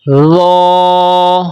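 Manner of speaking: رو said as a heavy "dho", held long; steady drawn-out "dho" for رو, full and unwhistled; thick, no whistle. Shown as a demonstration. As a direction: recite رو with a thick th as in "this". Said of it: "موٹی آواز سے بھی پڑھیں گے".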